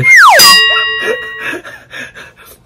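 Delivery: loud high laugh breaking out; big burst of laughter. Real, not acted. Vlogger cracking up at her own joke.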